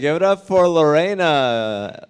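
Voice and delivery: loud, slow host call-out; host announcement. Real, not acted. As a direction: announce them to the crowd. Host calls applause.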